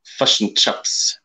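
half-voiced, hammed-up New Zealand accent; bad New Zealand accent. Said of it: "New Zealand accent".

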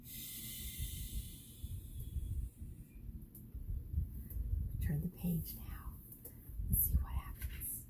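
hush, then whisper to the class; a secretive whisper to draw them in. Real, not acted. Teacher hushing the children before the page turns, whispering.

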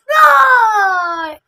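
a big loud troll roar from under the bridge. Acted. Troll bursting out at the goats, loud roar. Troll leaps out at biggest goat.

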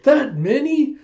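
high, loud amazed question; hammed-up wonder; mock astonishment. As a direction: play gobsmacked, pitch leaping up. Acted astonished reply among excited friends.

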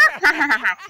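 gloating hahaha; mocking laugh. Laughing at the skeleton's failure, gloating. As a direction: laugh at the loser's expense.

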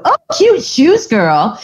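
high, bubbly praise for a stranger; gushing compliment. Retold or imitated. Woman praising a random stranger's shoes on the street, high and gushing. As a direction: squeal it like greeting an old friend. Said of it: "and go".

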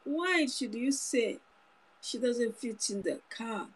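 ordinary talk

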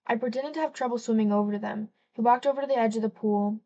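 usual speaking voice

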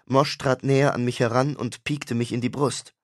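usual speaking voice

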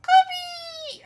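very high, loud squeal; falsetto squeal. Shown as a demonstration. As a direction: squeal the name in a piercing falsetto. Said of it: "How did I do it?".

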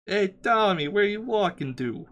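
put-on New York accent. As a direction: heckle like a New Yorker. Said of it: "It's New York".

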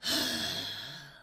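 low, long, heavy exaggerated sigh; theatrical sigh; big dramatic sigh. Shown as a demonstration. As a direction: sigh like it's the end of the world. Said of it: "So she's like".

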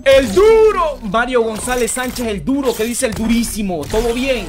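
loud, high drawn-out announcement; hyped shout-out. Real, not acted. Streamer greets Mario the tough guy, loud hyped shout-out. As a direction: hail the viewer like a champion.

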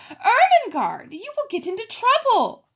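high, loud alarmed cry of the name; surprised cry. A narrator's reading. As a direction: gasp out the warning to her friend. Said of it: "She opened the door and cried".

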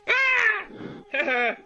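sudden high-pitched yelp; startled high yelp. Acted. Mosquito caught by hand.

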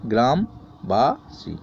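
গ্রামবাসী drawn out slowly, syllable by syllable; গ্রামবাসী spoken very slowly to show the syllables; slow syllable split. Shown as a demonstration. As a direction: stretch গ্রামবাসী into its syllables for the class. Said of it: "আমি একটা দিয়েছি".

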